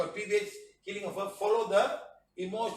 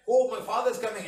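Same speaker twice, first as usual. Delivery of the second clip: higher and louder, happy cry; joyful welcome; 4 semitones higher, 6 dB louder than usual. Retold or imitated. Children greeting the father, voiced as a high happy cry. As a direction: glad the father is home.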